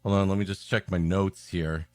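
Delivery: ordinary delivery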